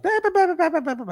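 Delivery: mock yammering, far above your own pitch; high-pitched chatter. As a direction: imitate a chatty buddy in a high squeaky babble. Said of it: "he's got that high-pitched voice".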